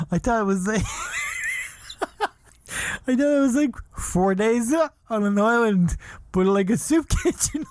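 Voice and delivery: high, laughing confession; giggling through an embarrassed admission. Real, not acted. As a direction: barely get it out for laughing.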